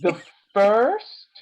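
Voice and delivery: drawn out slowly; slow motion. Shown as a demonstration. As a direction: stretch every word out slowly. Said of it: "let me say that in slow motion".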